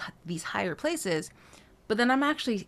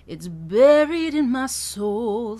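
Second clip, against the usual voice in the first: slow, louder, weight on "buried"; anchor on buried; 4 semitones higher, 8 dB louder, much slower than usual. Shown as a demonstration. Voice coach leaning into "buried" for students. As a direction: lean hard into "buried". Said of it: "anchor point on the word bare of buried".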